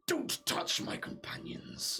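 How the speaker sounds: slow rasping whisper of an undead king; ancient rasping undead king. Acted. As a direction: breathe it out slowly like an ancient lich. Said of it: "you hear the Lich's voice again".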